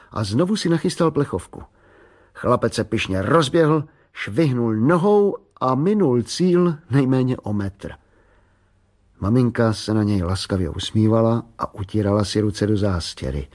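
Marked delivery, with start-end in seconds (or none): none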